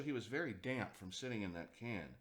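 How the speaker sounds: ordinary delivery, nothing marked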